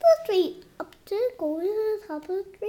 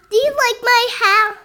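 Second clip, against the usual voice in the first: high character voice; 5 semitones higher, 10 dB louder than usual. Acted. Child voicing a dog character.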